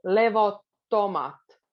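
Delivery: "levottomat" with a long held double T. Shown as a demonstration. Teacher slowing down to show a double T in "levottomat". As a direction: lean into the double T of "levottomat", slowly. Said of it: "we still put a bit more focus on the double T".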